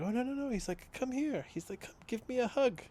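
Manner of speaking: high, light mock German accent for a warm invite; lightly hammed German accent, coaxing; imitating Udo Kier's German accent. Tattooist's story, Udo Kier hugging a fan, mock German accent. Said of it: "in his, like, his little accented voice".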